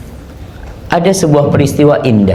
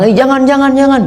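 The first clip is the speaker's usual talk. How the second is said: people forbidding; 12 semitones higher than usual. Acted. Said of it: "orang-orang melarang".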